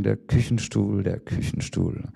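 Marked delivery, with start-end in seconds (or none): 1.0-2.0 whispered echo. Acted. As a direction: repeat it as a soft, whispered echo. Repeated line in poem.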